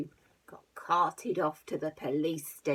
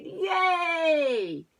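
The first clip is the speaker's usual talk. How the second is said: cheering shout; 10 semitones higher, 8 dB louder than usual. Acted. Farmer cheers.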